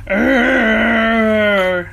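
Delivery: gruff imitated bark; dog woof imitation. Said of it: "and then he".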